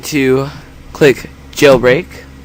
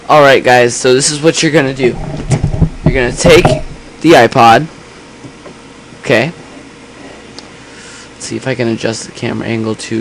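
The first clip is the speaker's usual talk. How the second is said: louder, more echoey camera mic; camera mic sound; 4 dB louder than usual. Real, not acted. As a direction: speak into a sensitive camera mic. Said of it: "the camera mic's a bit s-, too sensitive".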